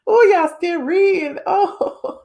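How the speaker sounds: loud, high exclamation breaking into laughter; amused outcry. Real, not acted. Streamer seeing chat still reading, loud laughing outcry. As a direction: cry out high, tickled by it.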